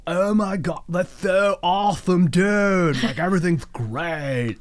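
slow, upbeat dim-witted ogre voice; dopey ogre voice. Acted. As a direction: play a thick, overjoyed ogre with an underbite.

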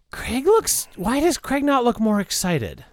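high, puzzled wondering; baffled aside. Real, not acted.

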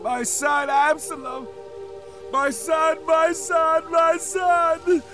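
very high grief-stricken cries; anguished wailing. A narrator's reading. King behind the gate mourning his slain son, wailing. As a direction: wail for a dead child. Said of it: "The pain was unbearable".

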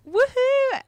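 high excited whoop; cheering. Real, not acted. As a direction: cheer like the crowd goes wild. Cheering the actor's entrance.

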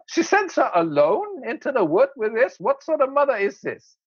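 high-pitched, mounting indignant rant; mock outrage. Father judging the girl's mother, mock outrage. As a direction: protest it as if scandalized.

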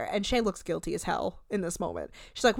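usual speaking voice

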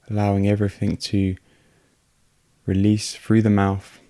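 unhurried meditation voice with long pauses; guided meditation. Real, not acted. Host guiding listeners through a breathing meditation, calm and unhurried. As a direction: guide calmly in short, evenly pitched phrases, leaving space for breath.

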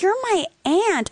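high, slow, wounded insistence; hurt outrage. Retold or imitated. Niece reminding her aunt of family ties, wounded and loud. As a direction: lean hard on the betrayal. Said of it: "I'm like".

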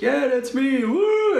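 very high, drawn-out re-enacted cheer; excited whoop. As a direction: call back and break into a high "woo". Answers a friend calling on trail.

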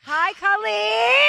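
high, drawn-out singsong hello; gushing greeting. Real, not acted. Greeting the guest on air.

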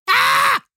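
high, loud mock scream. Imitating a reaction as a kid, mock scream. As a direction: scream it sky-high, hammed up.

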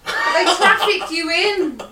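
loud, high, slow laughing. Real, not acted. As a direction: laugh through the words. Laughing at the joke.

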